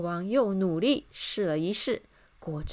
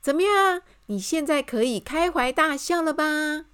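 high, loud cheerful question; elated; 8 semitones higher, 7 dB louder than usual. A narrator's reading. Miss Optimist invites the king to laugh, elated. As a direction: burst out in high spirits. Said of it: "兴高采烈地说".

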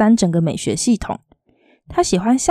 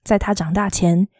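plain reading voice

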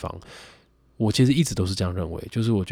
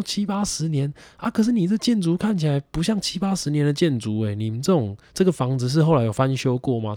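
curious; 8 semitones higher than usual. Retold or imitated. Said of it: "我说".

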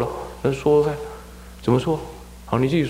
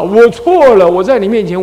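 high, loud declaration; loud confession; 8 semitones higher, 8 dB louder than usual. Acted. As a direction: proclaim your fault out loud. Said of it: "我说宣告我错了".

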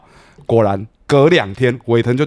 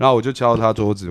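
normal talking voice

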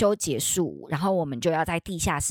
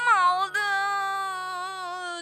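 spooked; 13 semitones higher than usual. Real, not acted. Scared retelling a ghost sighting.

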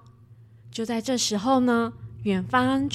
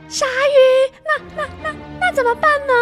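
put-on high, loud frightened child's cry; panicked little girl; 8 dB louder than usual. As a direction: stammer in panic like a scared girl. Child panics about shark.

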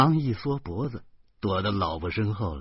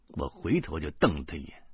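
usual speaking voice

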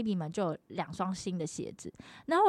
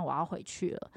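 ordinary talk, nothing marked

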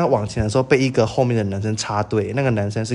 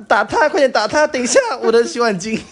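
high, gleeful cheering; egging them on; 11 semitones higher, 5 dB louder than usual. Retold or imitated. Girl gleefully cheering on the fight. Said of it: "我说".

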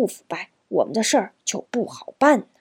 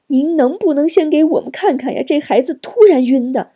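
raised, pressing, worried plea; anxious pleading; 7 semitones higher, 5 dB louder than usual. A narrator's reading. Anxious mother begs the nurse to look first, high urgent pleading. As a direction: beg for help, voice pushed up with worry. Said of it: "急得何晶直央求护士".

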